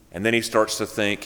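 ordinary delivery

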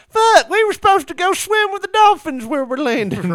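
high, loud complaining Karen; whiny Karen. Retold or imitated. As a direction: squeal it like an angry Karen. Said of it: "Karens that are like".

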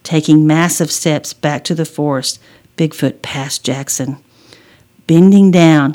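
plain reading voice

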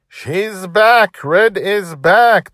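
high, loud, drawn-out cheer; elated child's holler; jubilant shout. A narrator's reading. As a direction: shout the triumph out, stretching the words. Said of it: "Dragon Little shouted again".

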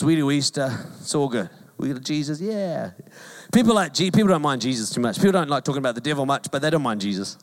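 usual speaking voice

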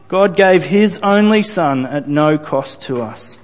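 normal talking voice

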